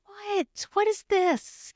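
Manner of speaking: high, startled voice; shocked by unexpected spotting, high and startled; alarmed. Retold or imitated. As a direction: spiral up in alarm. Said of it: "and I'm thinking".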